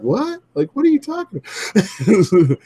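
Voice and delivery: high, incredulous question; retold disbelief. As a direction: act out being taken aback. Retelling their stunned reaction to insult.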